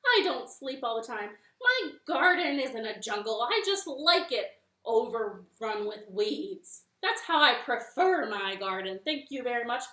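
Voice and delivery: indignant spider. Acted. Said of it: "Anansi was so mad".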